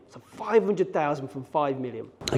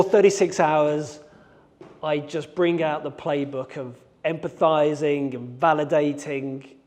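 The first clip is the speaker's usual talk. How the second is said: ordinary talk, nothing marked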